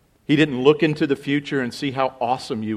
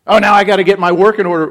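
quick, louder mock grumble; 5 semitones higher, 6 dB louder, faster than usual. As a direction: whine like someone fed up with rules. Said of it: "You don't have to go".

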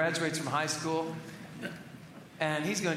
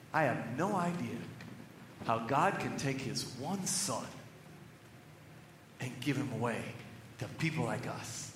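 hushed awe; 8 semitones lower, 7 dB quieter than usual. Real, not acted. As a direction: let the wonder quiet your voice.

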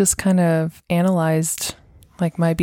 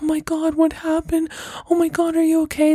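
much higher, frantic fussing mother; panicked mom; 9 semitones higher, faster than usual. Retold or imitated. Mom fussing over her child's scraped knee, panicked and high. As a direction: gush out the worry like a panicking mom.

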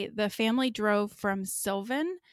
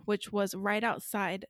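ordinary delivery

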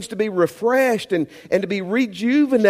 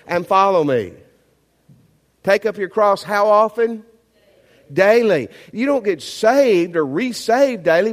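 full-force preaching; 3 semitones higher, 4 dB louder than usual. Acted. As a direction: preach it out, pleading with the room.

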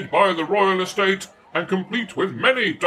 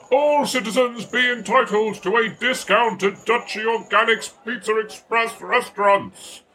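ordinary delivery

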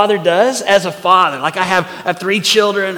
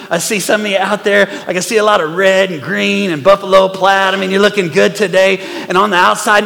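normal talking voice

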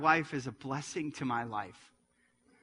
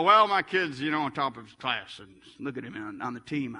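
put-on boastful parent in a Southern accent; 5 semitones lower, 4 dB louder than usual. Preacher mocking parents who compare kids, in a Southern accent. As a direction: brag with a put-on Southern twang. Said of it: "go to the Southern accent".

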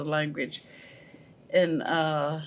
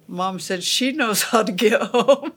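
laughing; 4 semitones higher, 4 dB louder than usual. Real, not acted. Teller laughs at the memory.

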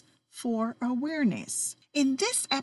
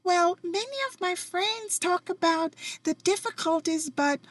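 much higher, bubbly singsong greeting; cheerful Aunt Sonia; 9 semitones higher than usual. Retold or imitated. Quoting her cheerful aunt answering the phone, high and bubbly. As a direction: chirp it out like a sunny auntie. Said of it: "She laughed and she said".

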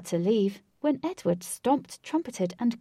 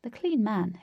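usual speaking voice

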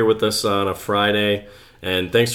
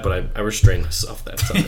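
ordinary talk, nothing marked

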